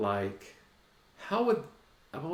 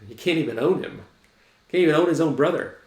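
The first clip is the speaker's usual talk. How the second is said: louder, faster emphatic point; emphatic preaching; 5 semitones higher, 7 dB louder, faster than usual. Real, not acted. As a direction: hammer the point home, fast.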